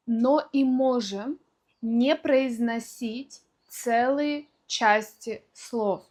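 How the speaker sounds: ordinary delivery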